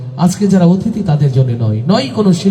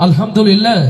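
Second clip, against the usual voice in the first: ordinary delivery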